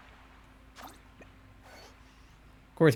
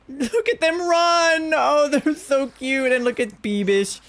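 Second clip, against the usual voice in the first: much higher, slow cooing voice; 9 semitones higher than usual. Real, not acted. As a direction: coo at them like babies. Gushing over baby Pokémon.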